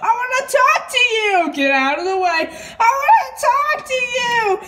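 high, chanting crying plea; sing-song wail. Real, not acted. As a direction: wail the same line over and over. Child wails repeatedly at door.

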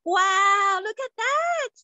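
amazed friends. Acted. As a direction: gasp at the sight in wonder. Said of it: "His friends came and said".

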